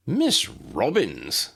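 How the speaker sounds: slow, drawn-out mock outrage; mock effrontery. A narrator's reading. Ron teasing Demelza, slow mock offence. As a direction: pretend to be scandalised. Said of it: "said Ron in mock effrontery".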